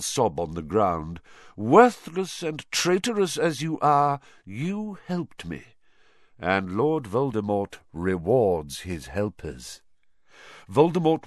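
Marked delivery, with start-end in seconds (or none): 1.6-9.8 scornful line falling to measured pronouncement; villain granting a grudging reward; imperious dark lord. Acted. Villain addresses his servant.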